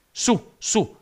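high, loud urging cry; captain's shout. A narrator's reading. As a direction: urge them upward with two sharp calls. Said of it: "gridò il capitano".

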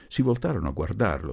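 ordinary delivery

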